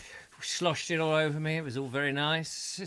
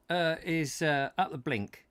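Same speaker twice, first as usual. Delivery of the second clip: ordinary talk, nothing marked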